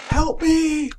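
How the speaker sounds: high, loud desperate scream; screaming for help. Acted. Voicing Monika begging Grant for help, loud high scream. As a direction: scream it out as the girl in pain. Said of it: "would sound like screaming that".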